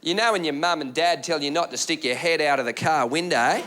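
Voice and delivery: Aussie heckler's explanation. Retold or imitated. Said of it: "And this is what he said".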